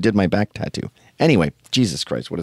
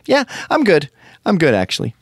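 much higher, breezy mock-polite no thanks; polite refusal; 8 semitones higher than usual. Will turning down the marketing lady, high mock-polite. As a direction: brush her off lightly in a high put-on voice.

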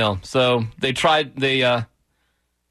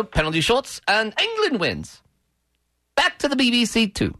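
mock English call; 4 semitones higher than usual. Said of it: "Let's hear the English call".

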